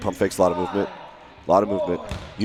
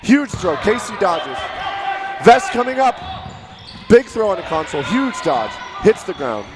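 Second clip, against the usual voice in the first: excited shouting; 8 semitones higher than usual. Real, not acted. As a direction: call the final rally hot. Endgame play-by-play.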